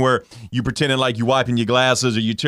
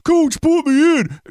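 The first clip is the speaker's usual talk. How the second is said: high, loud, drawn-out mock whine; whiny, mocking singer voice, pitched way up; 7 dB louder, slower than usual. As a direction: whine it like a pleading benchwarmer. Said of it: "it's a whiny ass song".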